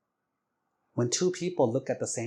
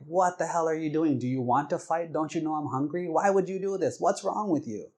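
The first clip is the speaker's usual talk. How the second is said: imitated angry tirade, rising in pitch; so mad; 5 semitones higher than usual. Hungry husband berating wife over the food, mad. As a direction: fire off indignant questions, building higher. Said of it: "he got so mad".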